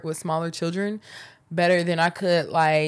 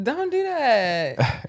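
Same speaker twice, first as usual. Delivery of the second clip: drawn-out protest; 5 semitones higher, much slower than usual. Real, not acted. Playfully protesting partner's impatient interruption.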